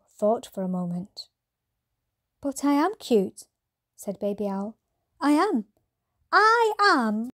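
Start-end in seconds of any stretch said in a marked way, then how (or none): 2.4-7.3 higher and higher, building to a squeak; a little owl admitting he is cute; squeaky cute owlet. Acted. Baby Owl admits he's cute.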